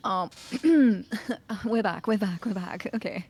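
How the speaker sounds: ordinary talk, nothing marked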